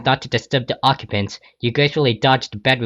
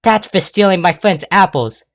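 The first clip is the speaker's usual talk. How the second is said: higher, louder furious scold; angry; 8 semitones higher, 5 dB louder than usual. Acted. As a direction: punish him for the apples. Rainbow slapping the thief.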